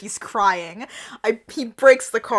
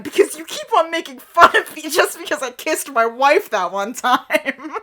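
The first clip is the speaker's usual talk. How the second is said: louder, higher mock-angry rant; acted angry accusation; real mad; 5 semitones higher, 3 dB louder than usual. A narrator's reading. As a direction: complain with put-on anger. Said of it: "he says real mad".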